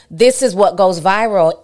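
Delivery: normal talking voice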